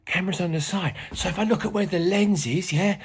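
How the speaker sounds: chatty informal pupil-like presenter; Ernie. Acted. Teacher plays Ernie, a helper.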